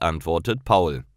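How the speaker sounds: ordinary delivery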